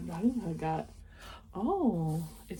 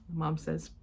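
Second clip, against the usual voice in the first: ordinary delivery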